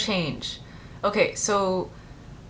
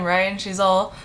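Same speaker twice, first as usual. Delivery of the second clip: ordinary talk, nothing marked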